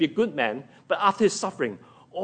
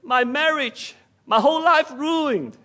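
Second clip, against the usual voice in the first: mock self-pitying Mary; 8 semitones higher than usual. Preacher imagining a self-centered Mary, whiny and higher. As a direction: wail in mock self-pity. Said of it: "If Mary was so self-centered".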